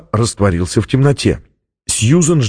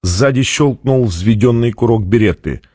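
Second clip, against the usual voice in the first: usual speaking voice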